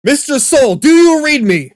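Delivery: loud, high, panicked radio call; urgent shout. Shown as a demonstration. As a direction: yell it like the signal's breaking up. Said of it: "someone starts sounding like their audio is distorting".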